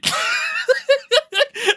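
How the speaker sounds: loud, high-pitched laugh; high laughing fit; laughter. Real, not acted. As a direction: burst out cackling at your own rant. Cracks up at own rant.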